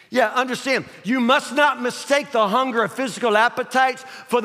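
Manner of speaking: high, loud, driving sermon; full-force preaching. Real, not acted. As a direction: preach it hard, punching the key words.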